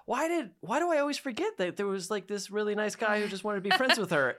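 mock bewildered wail; exasperated. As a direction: pitch up at your own forgetfulness. Self-exasperated inner voice.

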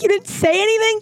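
mock-shocked squeal. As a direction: replay your own disbelief. Retelling her own shocked reaction.

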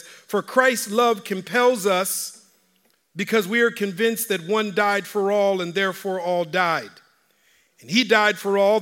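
scripture reading. Acted. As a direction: proclaim the verses with weight. Reads scripture aloud in sermon.

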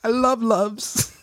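high, slow, swooning; high slow doting coo; mock gush. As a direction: coo over baby diapers, hammed up. Mocking tearful diaper-ad gush.

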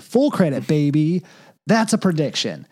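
high and loud triumphant call; excited. Acted. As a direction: crow about the win. Celebrating own prediction.

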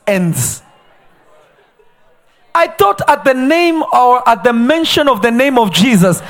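loud rising sermon voice; fiery preaching. Acted. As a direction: drive the point home. Preaching at full force.

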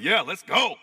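acted burst of eager hype; fired up. God cheering on someone who's done nothing yet, fired up. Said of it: "he's just so fired up".

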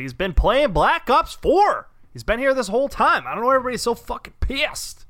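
hammed-up clueless complaint; mocking MBS voice. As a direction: play the baffled tyrant who can't see the problem. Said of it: "It's like".

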